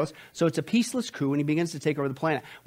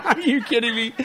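laughing disbelief; 6 semitones higher, 5 dB louder than usual. Real, not acted. Laughs at his own sarcasm.

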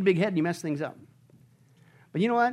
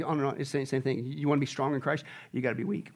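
ordinary talk, nothing marked

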